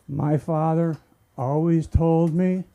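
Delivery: normal talking voice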